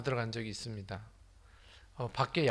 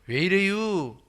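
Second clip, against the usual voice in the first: louder, higher Chungcheong dialect; Chungcheong-accented question, pitched up and loud; 4 semitones higher, 5 dB louder, slower than usual. Shown as a demonstration. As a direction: ask it like a Chungcheong local. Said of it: "충청도는".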